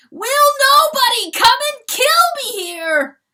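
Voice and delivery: very high, loud scream; desperate scream. A narrator's reading. Mortified rooster screaming for death, very high and loud. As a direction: scream to be killed.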